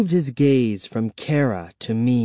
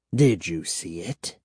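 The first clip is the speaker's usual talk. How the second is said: put-on old vampire; 6 semitones lower, 4 dB quieter than usual. A narrator's reading. Old vampire questions the children.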